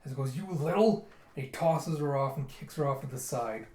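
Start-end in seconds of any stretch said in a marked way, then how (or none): 0.4-1.1 louder, raised cut-off curse; angry. Retold or imitated. Bitten guard tossing Yona off, angry. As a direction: start an insult with mock fury.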